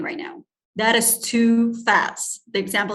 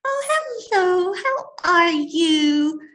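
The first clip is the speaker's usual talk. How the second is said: put-on much higher, slow, louder baby talk; 8 semitones higher, 4 dB louder, slower than usual. Shown as a demonstration. Teacher greets a baby, high slow baby talk. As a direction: coo it as if to a baby. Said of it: "speaking to a baby, your tone will change".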